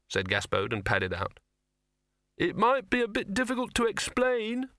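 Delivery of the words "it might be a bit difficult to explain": high called-out line; calling after. Acted. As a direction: call after someone leaving. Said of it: "Victor called after him".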